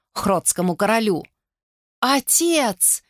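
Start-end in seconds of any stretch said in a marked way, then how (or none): 2.0-3.0 much higher, breathless cry of disbelief; astonished gasp. A narrator's reading. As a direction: gasp it, unable to believe your eyes. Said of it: "не веря своим глазам, выдохнула".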